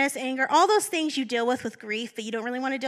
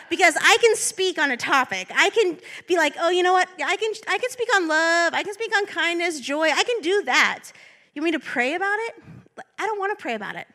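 whiny reluctance; 5 semitones higher, 4 dB louder than usual. Retold or imitated. Mocking her own reluctance.

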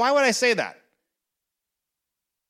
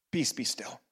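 much lower, much softer soothing words; hushed calm; 9 semitones lower, 11 dB quieter than usual. Acted. Pastor answering a panic attack, hushed and low. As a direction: lay it down gently like stilling water.

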